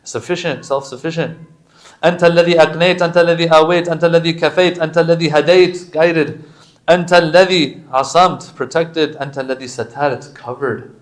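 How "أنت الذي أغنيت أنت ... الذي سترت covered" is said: louder, raised, insistent litany; Arabic supplication recital. Acted. Imam's prayer recited to a congregation, loud devotional litany. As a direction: recite the praises in a driving, raised voice.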